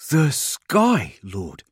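high, shocked exclamation falling away. A narrator's reading. Hegain sees the sky over the hive, shocked. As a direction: start loud in shock, drop on the last word. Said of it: "sounding shocked".

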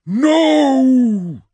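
long, high, booming shout. A narrator's reading. Unseen voice halts the warriors, booming. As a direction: let it carry across a desert. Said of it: "a voice boomed across the desert".

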